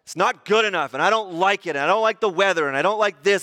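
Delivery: petulant listing, acted out; grumbling. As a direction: reel off gripes as a hammed-up grumbler. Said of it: "We just grumble about everything".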